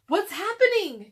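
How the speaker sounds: high, loud panicked question; alarmed cry. Acted. Jack cries out as the treehouse spins. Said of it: "cried Jack".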